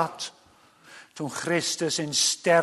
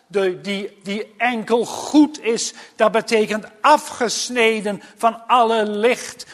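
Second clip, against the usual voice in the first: hoge, krachtige preekstem; vurige preek; 4 semitones higher, 4 dB louder than usual. Acted. (vurig en met verheven stem prediken)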